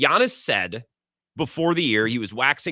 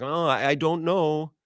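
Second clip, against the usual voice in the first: a faint, slow attempt at a Giannis accent; failed Giannis accent; 2 semitones higher, slower than usual. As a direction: try Giannis's accent and give up halfway. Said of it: "I can't do a Giannis accent".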